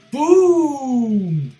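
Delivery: loud, high mock blast yell; boom shout. Naruto's attack landing, hammed-up loud boom. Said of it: "He's like".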